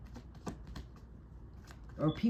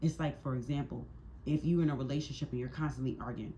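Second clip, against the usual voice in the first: normal talking voice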